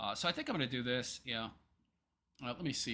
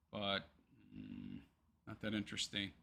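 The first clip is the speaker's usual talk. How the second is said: ordinary talk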